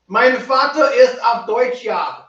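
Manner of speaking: laughing, loud, slow hammed-up German line; mock German. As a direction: ham up being German, loud and drawn out. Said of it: "I am German".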